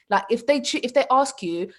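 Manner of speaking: ordinary delivery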